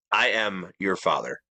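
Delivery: ordinary talk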